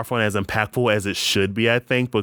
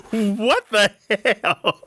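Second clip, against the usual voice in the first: mock outrage, pitched way up; incredulous; 11 semitones higher, much slower than usual. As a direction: exaggerate your bewildered reaction for laughs. Retold disbelief watching a film.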